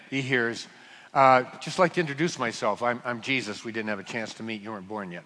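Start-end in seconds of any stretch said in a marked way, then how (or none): 1.6-5.2 softer, lower, casual and kind; a light, friendly introduction, fading softer; gentle understated Jesus voice. Retold or imitated. Jesus calmly introducing himself to Saul, softly and kindly.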